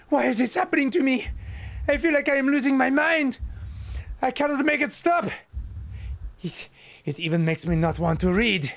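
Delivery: high, racing, breathless panic; spiralling into frantic excitement; frantic, overwhelmed. Acted. Yuri losing control.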